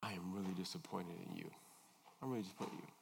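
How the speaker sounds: soft, hushed disappointment; quiet, calm rebuke to a child. Shown as a demonstration. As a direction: say it low and very calm, barely above a breath. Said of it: "in a very calm voice".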